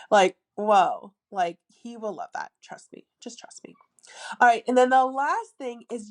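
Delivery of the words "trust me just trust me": whispered aside. Real, not acted. To viewers, a whispered confiding aside. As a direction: whisper it, no voice at all.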